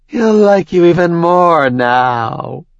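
high, slow gloating purr. Acted. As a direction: savour your cruel victory. After laughing, gloating.

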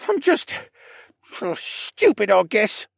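put-on high, young boy voice, slow and miserable; a kid beating himself up, dragging the words; upset boy. Stable boy berates himself to Alex, high and miserable. Rylan self-reproach.

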